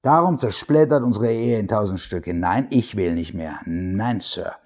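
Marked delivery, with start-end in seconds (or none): none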